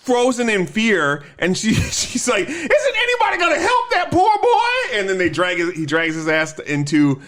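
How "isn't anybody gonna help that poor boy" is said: mock woman's voice. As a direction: squeal it as the hypocritical woman, laughing. Same woman voiced again.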